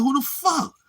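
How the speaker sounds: high, loud confused outburst; baffled outburst. Retold or imitated. Recalling hearing the song, a baffled high outburst. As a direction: blurt it out, totally lost. His own confused reaction.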